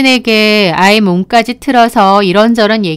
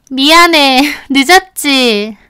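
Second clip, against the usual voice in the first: higher-pitched friend's voice, lightly apologetic; breezy late arrival; 4 semitones higher, slower than usual. Acted. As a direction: greet the group with a drawn-out, high apology. Friend arrives late, greets group.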